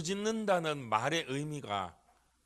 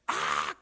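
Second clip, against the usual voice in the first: retold scream; 12 semitones lower, 5 dB louder than usual. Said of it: "비명이 나오더라고요".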